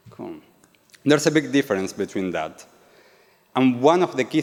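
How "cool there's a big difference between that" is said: normal talking voice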